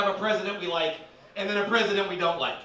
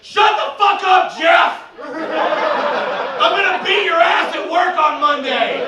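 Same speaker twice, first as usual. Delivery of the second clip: much higher and louder, slow furious shout; angry fan yell; 9 semitones higher, 10 dB louder, slower than usual. Retold or imitated. A fan yelling at a coworker in the crowd, furious. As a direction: bellow it across the rows. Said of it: "a guy three rows over goes".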